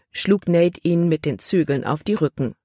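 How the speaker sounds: ordinary delivery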